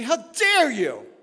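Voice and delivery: high, loud, slow indignant rebuke; shocked rebuke. Retold or imitated. Preacher voicing believers rebuking a blasphemer, loud outrage. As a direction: rear back in outrage. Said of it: "We'd rear back and say".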